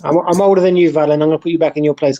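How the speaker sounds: ordinary delivery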